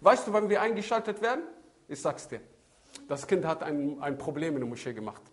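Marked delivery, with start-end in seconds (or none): none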